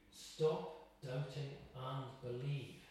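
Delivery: very slow, each word laid down; slow, weighty voice of Jesus. Acted. Preacher voicing Jesus's command, slow and grave. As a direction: give Jesus's command with gravity. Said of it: "Jesus is saying to you".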